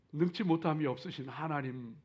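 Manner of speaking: ordinary delivery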